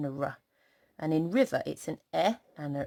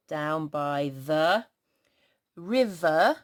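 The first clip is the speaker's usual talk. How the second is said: slow reading; much slower than usual. Shown as a demonstration. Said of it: "rereading the sentence slowly".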